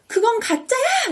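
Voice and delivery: high, drawn-out mock yell; acted outburst. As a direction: suddenly cry out in protest, stretching it. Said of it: "이렇게 소리를 질러요".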